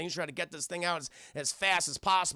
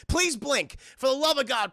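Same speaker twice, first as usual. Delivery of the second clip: higher, louder comic begging; mock desperate plea; 7 semitones higher, 5 dB louder than usual. Streamer pleading with unblinking puppets to blink, mock desperation. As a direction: beg in exaggerated exasperation. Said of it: "It's just like".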